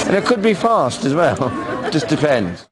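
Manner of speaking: put-on camp sixties London accent; Austin Powers voice.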